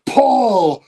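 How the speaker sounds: MC intro building to a loud, high name; mimicking an announcer who blanks mid-introduction; imitated ring announcer. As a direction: announce big, then get stuck in a long stretched cry. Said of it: "he forgot Paul Smith's name".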